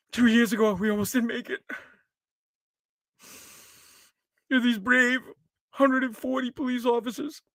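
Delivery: mock-solemn speech with long pauses; hushed, grave politician send-up; Hakeem Jeffries. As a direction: parody a reverent memorial speech. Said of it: "his tone of voice, like".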